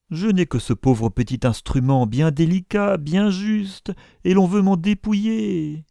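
high, wailing protest; aghast outcry. A narrator's reading. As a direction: plead and lament as if being robbed. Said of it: "s'écria Isaac Acabut". (protestation éplorée et aiguë)

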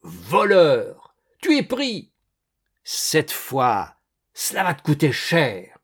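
loud, accusing outburst; angry yelling. A narrator's reading. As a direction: shout threats at a caught thief. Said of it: "hurla monsieur Magnon".

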